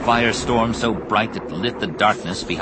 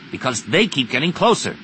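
raised, irritated comeback; growled retort; 6 semitones higher than usual. A narrator's reading. Crewmate answering pilot in battle, growled retort. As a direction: argue back with a touch of a growl. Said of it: "Zek growled back".

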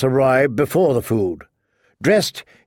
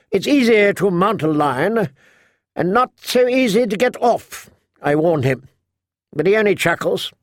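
higher-pitched, opinionated noblewoman; tart old matriarch; 6 semitones higher than usual. Acted. Lady Alina complaining of oafish husband and son, tart higher voice. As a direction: rattle on as a scornful mother.

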